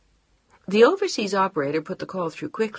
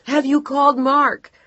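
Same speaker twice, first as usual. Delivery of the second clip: higher, louder urgent question; frantic shout; 9 semitones higher, 9 dB louder than usual. Acted. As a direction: keep yelling down the line. Frantic phone call.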